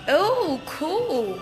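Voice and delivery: usual speaking voice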